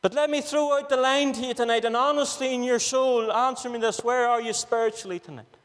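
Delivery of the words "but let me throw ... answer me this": ordinary talk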